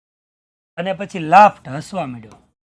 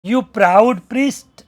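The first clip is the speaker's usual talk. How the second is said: angry insult; 3 semitones higher, 8 dB louder, much slower than usual. Retold or imitated. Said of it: "acted insult to priest".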